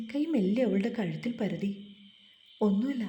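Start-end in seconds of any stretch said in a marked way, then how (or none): none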